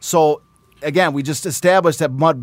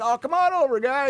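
high, breezy mock-friendly call; friendly Bucko; 10 semitones higher than usual. Bucko calling newspaper guys over, high and breezy. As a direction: wave the reporters over like old pals. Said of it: "he's like".

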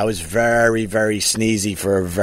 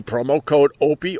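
ordinary talk, nothing marked

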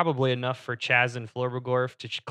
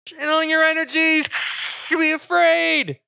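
high, faint voice from far off; dreamy distant voice; 5 dB louder than usual. Acted. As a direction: call out faintly through static.

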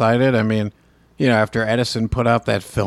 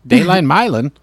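higher, louder newsreel headline through a laugh; old newsreel narrator; 7 semitones higher, 5 dB louder than usual. Acted. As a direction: announce it like a vintage newsreel. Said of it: "I'll break it out from here and there".

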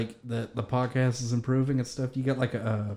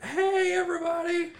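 excited greeting. Retold or imitated. Cheerful retold greeting at work.

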